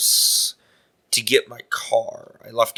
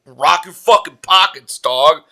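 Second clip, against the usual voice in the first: slow, higher-pitched mock threat; retold robber's demand; 4 semitones higher, 4 dB louder, slower than usual. Shirtless guy trying to rob a passerby, mock-menacing. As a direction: play a street robber making demands.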